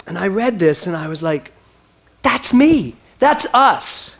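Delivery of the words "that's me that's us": high, loud, punchy exclamation; two emphatic bursts of "that's it!"; excited recognition. Retold or imitated. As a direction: react with delighted recognition. Acts out excited reaction to post.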